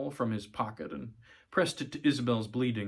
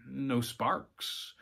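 ordinary delivery, nothing marked

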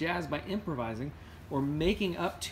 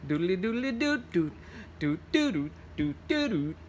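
higher, quick trumpet scat; 4 semitones higher than usual. Retold or imitated. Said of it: "Actually, he would go".

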